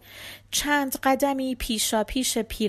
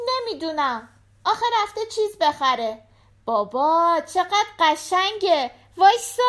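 put-on high, eager child's voice; little girl voice; 8 semitones higher, 5 dB louder than usual. A narrator's reading. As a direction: play the small daughter at the door. Daughter voiced.

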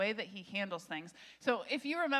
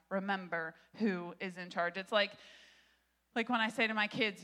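usual speaking voice

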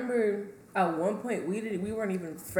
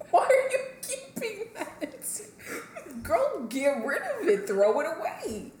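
laughing mock scold; 9 semitones higher, 3 dB louder, slower than usual. Scolding the cousin, acted.

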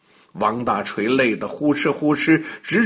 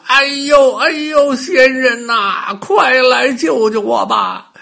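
high, loud yelps of pain easing into a plea; a crying shout for rescue that settles into begging; 7 semitones higher, 5 dB louder than usual. Acted. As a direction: howl for help as the chisel bites. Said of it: "王大锤疼得大叫起来".

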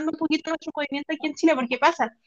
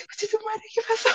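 higher, panicked retold cry; terrified cry; 9 semitones higher, 6 dB quieter than usual. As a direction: yelp it out in fright. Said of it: "Y la dos así".

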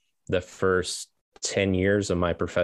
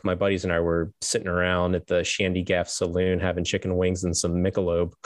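ordinary talk, nothing marked

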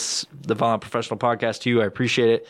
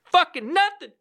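much higher, squeaky mock outrage; exasperated squeal. Podcaster squealing about his unchanged computer settings. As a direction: squeal it in disbelief.

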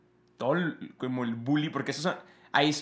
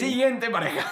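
announcer voice; 9 semitones higher, 4 dB louder than usual. Acted. Host-like announcing.

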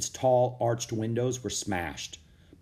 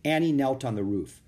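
usual speaking voice

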